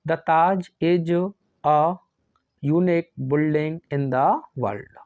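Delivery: very slow, each word drawn out for copying; slow dictation. Shown as a demonstration. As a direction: read it out slowly so students can write. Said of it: "मैं बोल देता हूँ".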